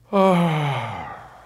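loud, falling sigh; heavy sigh. Acted. Preacher wishing it were easier, heavy sigh. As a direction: let out one long weary sigh. Long sigh after weary wish.

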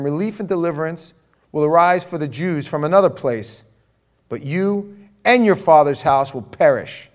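loud, forceful scripture proclamation; preached scripture reading. Acted. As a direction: thunder the verse out to the congregation. Reads Mordecai's words to Esther.

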